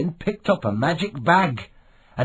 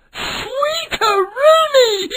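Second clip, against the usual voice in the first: joyful pirate cry; 4 dB louder, much slower than usual. A narrator's reading. Milo opens the chest.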